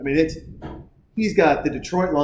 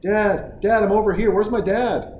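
put-on high calling kid voice; 6 semitones higher than usual. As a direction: call for dad like a lost kid. Voicing kid calling for dad.

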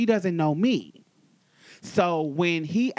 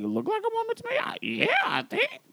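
high-pitched, baffled bystander; mock confused girl, high voice; imitated confusion. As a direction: voice her bewilderment way up high. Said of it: "She's basically just confused".

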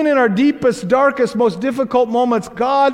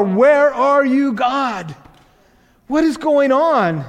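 slow, raised cry to heaven; anguished appeal; 4 semitones higher, slower than usual. Acted. Crushed believer calling out to God, anguished. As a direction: call out with drawn-out, pleading words.

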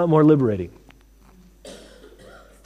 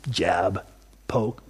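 breathy whispered "jab"; 9 semitones lower, 9 dB quieter than usual. Acted. Preacher miming the goad to the congregation, whispered. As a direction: hiss it like a quick prod.

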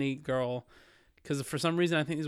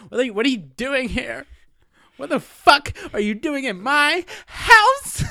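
high, loud, tearful screaming fit; hammed-up furious movie outburst; 10 semitones higher, 10 dB louder, slower than usual. Acting out the Magnolia girl's meltdown, wailing angry yell. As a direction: build to a slow, wailing, outraged yell. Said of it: "that part where she's like".

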